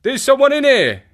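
high, loud cry of discovery; urgent cry. A narrator's reading. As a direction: call out to alert the others. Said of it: "cried Lestrade".

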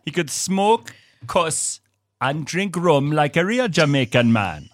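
a light Jamaican lilt, slowed and sing-song; imitating an uncle's Jamaican accent, slowed down. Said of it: "I love that accent".

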